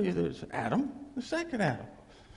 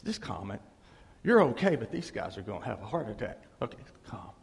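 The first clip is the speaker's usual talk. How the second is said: soothing; 8 semitones lower than usual. Retold or imitated. Calming frightened disciples in a retelling.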